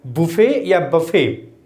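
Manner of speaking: बुफे said slowly as boo-fay, silent t; slow careful बुफे, no t at the end; correct pronunciation. Shown as a demonstration. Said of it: "इसका correct pronunciation है".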